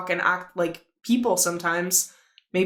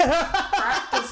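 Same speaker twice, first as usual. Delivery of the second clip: laughing; 7 semitones higher, 3 dB louder than usual. Real, not acted. Laughs, repeats the phrase.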